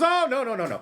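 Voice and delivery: high, loud, brushing-off "oh no no"; old aunt refusing. Retold or imitated. Aunt refusing to be made old again, high and emphatic. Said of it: "And she goes".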